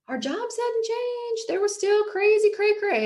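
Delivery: high sing-song about crazy cray cray jobs; playful high voice. Acted. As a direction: float it up high, teasing.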